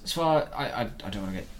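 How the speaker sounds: normal talking voice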